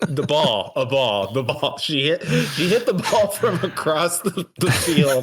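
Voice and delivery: helpless giggling mid-sentence; laughing. Real, not acted. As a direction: barely get it out for laughing. Game master cracks up over death.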